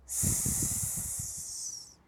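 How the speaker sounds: hissed s sound alone. Shown as a demonstration. Teacher demonstrating the letter sound, a long hissed s. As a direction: hiss a long s for the kids. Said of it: "في اكشن كده".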